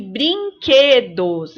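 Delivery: "brinquedos" slow, QUE held long; teacher's slow brin-QUEEE-dos; stressed syllable. Shown as a demonstration. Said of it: "pintem as sílabas tônicas".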